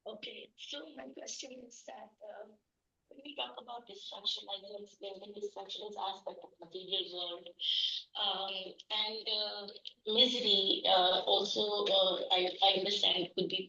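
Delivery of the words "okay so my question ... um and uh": very faint headphone-mic voice; speaking through headphones, far from the mic; headphones used as mic. Real, not acted. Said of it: "I'm talking through the headphones".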